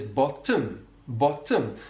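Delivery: "bottom" with the T held long, Welsh style; doubled consonant. Shown as a demonstration. Teacher imitating a Welsh speaker, doubled T. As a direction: extend the T between the vowels in "bottom". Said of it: "doubling or extension of a consonant".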